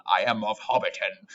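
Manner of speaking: lightly hammed-up medieval knight; mock medieval declaration; old English knight. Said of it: "almost like how on, Old English people talk".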